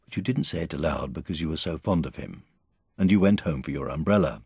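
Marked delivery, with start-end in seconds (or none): none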